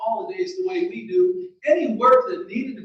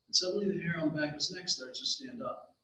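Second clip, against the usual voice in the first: plain reading voice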